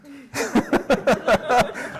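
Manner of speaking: bursts out laughing at himself; a real, amused laugh. Real, not acted. Laughs at his own solemn tone.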